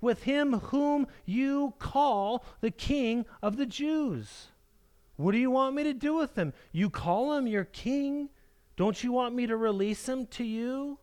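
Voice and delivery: Pilate begging the crowd to reconsider; pleading Pilate. Retold or imitated. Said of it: "Pilate said to them, he said".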